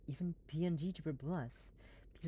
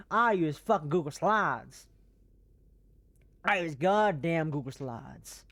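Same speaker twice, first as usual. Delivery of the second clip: mock rant; 3 semitones higher, 7 dB louder than usual. Mock rant about own PowerPoint.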